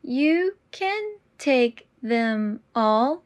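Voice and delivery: very slow and deliberate. Shown as a demonstration. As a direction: draw out every word. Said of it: "repetition drill, slowed take".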